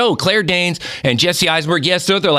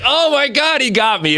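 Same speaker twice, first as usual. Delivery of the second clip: mock shock; 10 semitones higher than usual. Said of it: "And I'm like".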